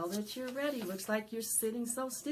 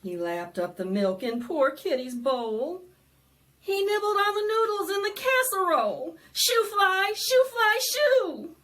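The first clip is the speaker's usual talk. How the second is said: louder, bouncy rhyme reading; lively read-aloud; 6 semitones higher, 11 dB louder than usual. Acted. Librarian reading the fly book to children, loud and bouncy. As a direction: perform the book for a crowd of kids.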